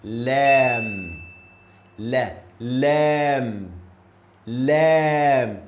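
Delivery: 'Lam' loud and drawn out; Arabic letter Lam. Shown as a demonstration. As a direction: model 'Lam' clearly.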